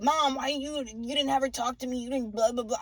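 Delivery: a touch of mock whining; mock complaining son. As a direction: lightly imitate a son griping to mom. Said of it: "he's bitching".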